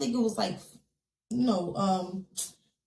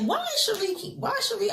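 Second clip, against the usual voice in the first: much higher, baffled wondering; puzzled self-quote; 11 semitones higher than usual. Retold or imitated. Retelling her confusion at Cherie's repeated calls, higher puzzled voice. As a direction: ask yourself why she keeps calling.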